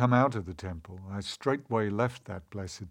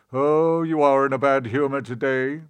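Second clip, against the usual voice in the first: louder, teasing god voice; good god; 3 semitones higher, 7 dB louder than usual. A narrator's reading. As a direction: tease a sulking brother.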